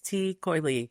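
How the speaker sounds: Tí Coilí said slowly, like tee KOY-lee; Irish pub name. Shown as a demonstration. Wife asks how to say the pub's name, careful slow Irish pronunciation. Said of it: "I don't remember how to say it".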